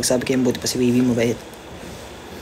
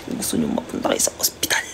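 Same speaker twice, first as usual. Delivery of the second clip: whisper; 11 semitones lower, 4 dB quieter than usual. Real, not acted. Aside warning to viewers.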